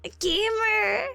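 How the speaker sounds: high, hammed-up indignant cry; playful high-pitched outrage at a viewer; mock outrage. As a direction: gasp at the chat in pretend shock. Mock outrage at chat comment.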